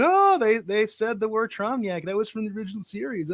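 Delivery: mock-excited fanboy, pitched high; geeking-out Trekkie. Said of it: "geeking out saying".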